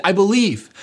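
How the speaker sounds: loud, high-pitched declaration of faith; a father's loud cry of belief. Acted. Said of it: "cried out and said".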